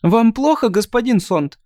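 put-on high chirping girl voice; chirpy thin voice. Shatra's voice continued.

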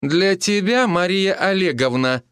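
high-pitched, cutting correction; curt correction. A narrator's reading. Healer puts familiar visitor in his place, curtly. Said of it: "отрезала она".

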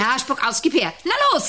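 very high, loud urging; urgent high push. Acted. Director urging the goat to spit out, loud. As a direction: hurry the goat, voice jumping up.

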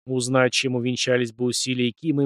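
usual speaking voice

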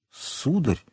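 soft, hesitant address, afraid to believe it; hardly daring to believe the luck, softly; hesitant disbelief. A narrator's reading. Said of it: "боясь поверить своему счастью".

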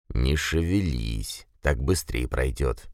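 low, slow, soft mumble; very old man. A narrator's reading. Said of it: "прошамкал старик".

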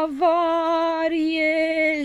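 long, high, drawn-out alarm call; Limonadă crying out that the balloon is failing. A narrator's reading. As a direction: sound the alarm, stretching the word. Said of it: "strigă Limonadă".

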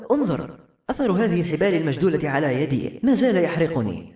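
ordinary delivery, nothing marked

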